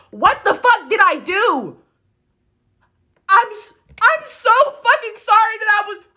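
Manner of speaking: high, strained voice on the verge of crying; fighting back tears, pleading; close to tears. Real, not acted. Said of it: "I'm not gonna cry on here".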